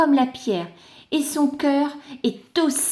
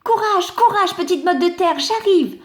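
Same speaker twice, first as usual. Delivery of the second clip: higher, louder rescuing call; heroic call; 7 semitones higher, 3 dB louder than usual. Acted. The rain cloud calling to the clod as it comes. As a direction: rush in to the rescue.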